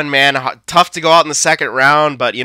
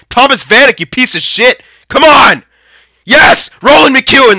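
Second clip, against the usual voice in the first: excited yelling; 11 semitones higher, 7 dB louder than usual. Real, not acted. Cursing player then goal cheer.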